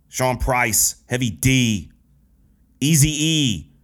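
normal talking voice

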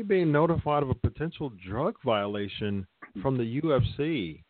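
usual speaking voice

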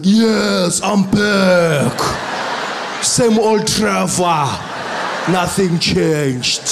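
loud, slow, exaggerated South African drawl; overcooked South African homecoming accent; overdone South African accent. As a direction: stretch every vowel in a hammed-up South African accent. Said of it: "I was losing my mind".

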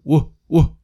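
ordinary talk

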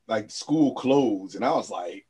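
normal talking voice

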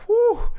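sharp high-pitched whew, mouth on fire; high whew of heat. Retold or imitated. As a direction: blow out a loud high whew like the spice just hit. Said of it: "and I'm like".

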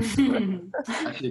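laughing. Real, not acted. Admits own YouTube exists.

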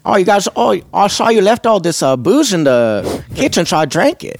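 hammed-up cheery Swedish bus driver accent; playful Swedish accent greeting the band; Bjorn's Swedish accent. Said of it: "can we do the accent?".

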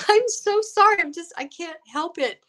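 high, squeaky sorry voice; mock apology. Her mock apology, quoted.

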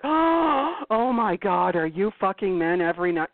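drawn-out scandalized "oh my God"; hammed-up horror at a dominatrix; mock shocked gasp. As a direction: play the clueless shocked customer. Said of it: "they're like, gasps".

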